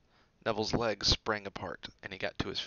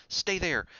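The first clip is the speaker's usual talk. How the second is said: high, quick command; excited; 9 semitones higher, 5 dB louder than usual. Acted. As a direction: rush the order. Hermione dashing off.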